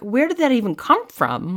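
indignant disbelief. Retold or imitated. Daughter on the phone questioning mom's assumption, indignant. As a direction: act out a baffled daughter protesting. Acted indignant reaction to mom.

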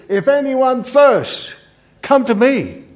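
high, loud, slow cry to the crowd; Jesus crying out. Acted. Jesus calling out to the crowd on the feast's last day, high and loud. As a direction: call it out high and slow, like a plea to everyone. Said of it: "he says".

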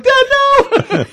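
put-on very high, loud little-girl squeal; daughter. Mocking the daughter's protest with a high squeal. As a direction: squeak it like a horrified child. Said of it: "She's like".

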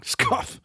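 breathy unvoiced scoff; jerk's scoff. Shown as a demonstration. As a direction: scoff it out like a jerk. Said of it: "That's Eddie the Jerk scoffing".

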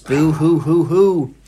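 slow, hammed-up boo hoo sobbing; fake crying. Mocking Hermione crying.

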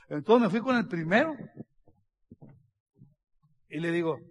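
ordinary talk

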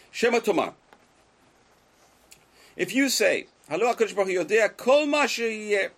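high, loud recital of a religious argument; declaimed text reading. Acted. Teacher reading the Rambam to students, loudly declaimed. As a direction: proclaim each point of the text to the class.

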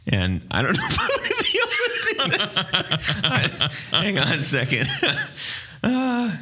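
cracking up mid-sentence; laughing. Real, not acted. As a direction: giggles breaking through the words. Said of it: "I'm trying not to laugh again".

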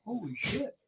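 high, very soft retold gasp of awe; hushed amazement. Lying in bed as a car passes, hushed amazement. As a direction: say it small and high, stunned.

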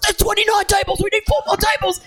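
shouting. Retold or imitated. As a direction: bellow it out in a frenzy. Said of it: "He just keeps shouting out".

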